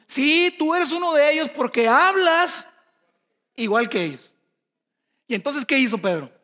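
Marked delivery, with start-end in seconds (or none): 0.1-4.3 louder, higher, slowed accusing cry; loud accusation. Acted. The crowd accusing Pedro of being a disciple, loud accusing cry. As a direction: call him out as one of them. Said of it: "le dijeron".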